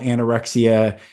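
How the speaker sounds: normal talking voice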